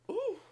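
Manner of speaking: sharp high gasp then ooh. Real, not acted. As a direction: gasp in shock. Shocked reaction to video.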